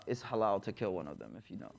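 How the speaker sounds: very low, hushed confidential voice; imitated murmur in someone's ear; retold whisper. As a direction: drop down as if slipping someone a secret. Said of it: "He whispers in his ears".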